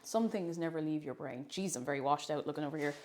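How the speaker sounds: normal talking voice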